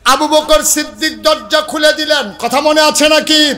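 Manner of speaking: loud, high-pitched sermon cry; high, ringing preacher's shout; full-force preaching. A narrator's reading. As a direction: belt out the dialogue at the top of the voice.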